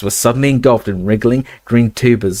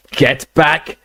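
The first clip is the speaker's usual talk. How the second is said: urgent warning shout; 5 semitones higher than usual. Acted. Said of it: "shouted Ron".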